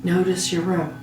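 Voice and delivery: slow, measured meditation voice with long pauses; guided meditation. Real, not acted. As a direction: guide a listener gently toward sleep, phrase by phrase. Leads a guided sleep meditation.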